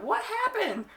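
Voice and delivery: very high-pitched startled question; mock alarmed mom. Mom seeing the child home after the punch, mock alarm. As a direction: imitate a mother's alarm, hammed-up.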